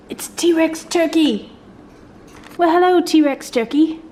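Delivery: mock excited hello to the toy; playful greeting.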